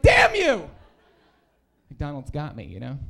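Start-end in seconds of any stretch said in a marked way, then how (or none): 0.0-0.7 much higher, loud cry of defeat; mock outraged yell. Comic cursing McDonald's, in a much higher, loud mock yell. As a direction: shake your fist at the sky. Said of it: "And I'm like".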